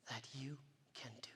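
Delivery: soft whispered close; whispering. Acted. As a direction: end in a whisper. Closing line whispered.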